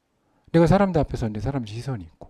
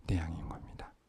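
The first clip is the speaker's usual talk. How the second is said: whispered punchline; 8 semitones lower, 18 dB quieter than usual. Acted. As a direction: drop to a hushed whisper. (속삭이듯 낮춘 결론)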